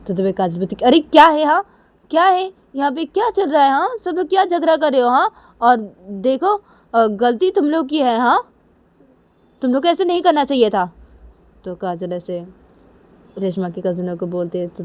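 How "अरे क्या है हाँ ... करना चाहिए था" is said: loud, high-pitched scolding; angry telling-off. Acted. Kajal confronts everyone fighting at breakfast, angry shouting. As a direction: shout over the squabble to stop it.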